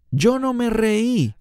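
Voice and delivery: hint of a caught-out [name] denying; defensive denial. [name] denies laughing, caught out.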